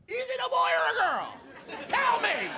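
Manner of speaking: high, loud, gushing demand; nosy excited stranger. Retold or imitated. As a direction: beg to know like a giddy busybody. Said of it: "You know?".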